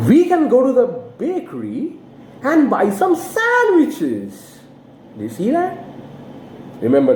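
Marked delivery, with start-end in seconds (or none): 0.1-4.6 slow high model, "bakery" falls, "sandwiches" rises; drill: rise on sandwiches. Shown as a demonstration. Teacher drilling repeat-after-me sentence, slow and high. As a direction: say "sandwiches" with a slow rising tune. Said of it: "You can practice after me".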